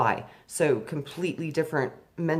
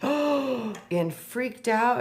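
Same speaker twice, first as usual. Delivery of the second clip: shocked gasp; 7 semitones higher, 3 dB louder, much slower than usual. Retold or imitated. Retold panic out of body.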